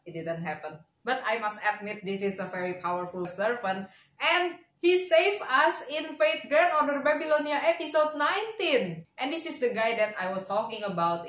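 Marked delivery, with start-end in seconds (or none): none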